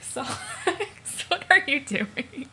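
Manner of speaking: laughing, breathy and unvoiced through the name; can barely get the words out for laughing; giggling at a castmate's prop teeth. Real, not acted.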